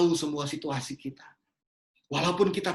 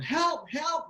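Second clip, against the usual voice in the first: mock cry for help; 9 semitones higher than usual. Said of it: "Lalu dia teriak dari balkon".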